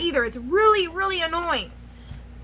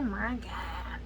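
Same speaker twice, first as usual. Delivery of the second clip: whispered to herself; 4 semitones lower, 13 dB quieter than usual. Real, not acted. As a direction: breathe it out unvoiced. Scolding herself.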